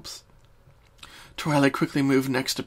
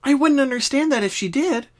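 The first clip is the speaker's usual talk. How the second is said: high, bouncy pony friend; Pinky voice; 12 semitones higher, 5 dB louder than usual. Acted. Pinky starts explaining her dreams. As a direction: answer like a cheerful pink pony.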